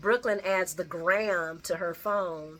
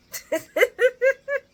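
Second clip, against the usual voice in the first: big laugh; 10 dB louder than usual. Real, not acted. Laughing at soap moment.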